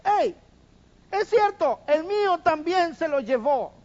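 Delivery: high, loud indignant agreement; accusing shout. Acted. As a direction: back up the accusation loudly. Second accuser joins in.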